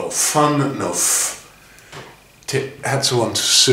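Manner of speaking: ファンのフ said slowly with the English F; slow fan-style フ with lip contact; English F sound. Shown as a demonstration. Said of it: "ファンのフって発音すると". (英語のFで「ファン」のフをゆっくり)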